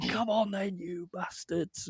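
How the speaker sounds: high, softened retold road rage; mock yell. Driver out the window at tailgaters, hushed mock yell. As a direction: imitate yelling at drivers without the volume. Retells window yelling, held back.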